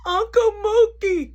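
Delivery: high, loud, slow crying plea; crying kid. Retold or imitated. Crying boy calling his uncle for help, high and wailing. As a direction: wail out the name through tears.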